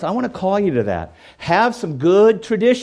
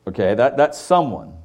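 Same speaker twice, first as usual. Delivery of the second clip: ordinary delivery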